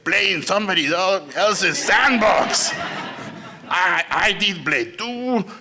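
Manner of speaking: big, slow, emphatic mentor voice; hammed-up impression of a filmmaker mentor; Guillermo del Toro impression. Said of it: "this is my Guillermo".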